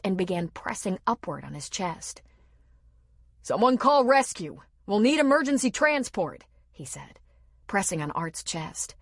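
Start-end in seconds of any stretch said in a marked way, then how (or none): 3.4-6.4 high, loud commands; urgent orders. A narrator's reading. Aiden calling for rescue while doing CPR. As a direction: bark orders over the body.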